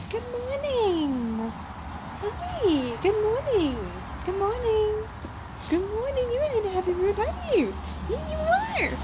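high, cooing pet voice; pet-talk greeting. Real, not acted. Greeting a pet in a happy mood, high cooing. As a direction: coo up high at a happy animal.

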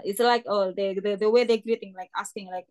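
ordinary talk

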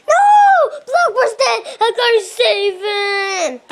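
high, loud mock despair; hammed-up anguished yell. Bird crying out over Blackbird, high and loud. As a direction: wail over the fallen friend. Finding Blackbird dead again.

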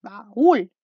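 ba-ÚL, second syllable strong; stress on the second syllable. Shown as a demonstration. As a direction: lean hard on "úl" in baúl. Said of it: "la que sonaba más fuerte".

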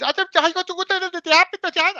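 high, loud put-on voice of the worker; mock drive-through worker. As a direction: squeak it out like a flustered cashier.

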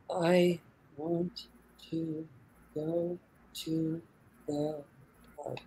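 each word separate and robotic; robotic monotone. Shown as a demonstration. Coach demonstrating memorizing in a robotic monotone. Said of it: "say it in a disinterested, monotone, robotic, rhythmic voice".

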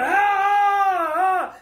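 high loud yelps of pain; pained cry. Retold or imitated. Woman whose arm is caught in the bus doors, loud pained yells. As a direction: cry out as the door traps her arm.

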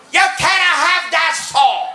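put-on high-pitched caricatured Japanese accent; mock Japanese businessman. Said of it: "this little Japanese businessman comes running up".

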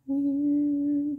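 high squeak on the word; high squeaky weird. Real, not acted. Same word pitched up high.

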